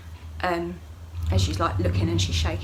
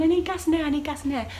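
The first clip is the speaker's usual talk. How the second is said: high-pitched retold begging; insistent plea; 8 semitones higher, faster than usual. Labouring woman asking for gas and air, high insistent plea.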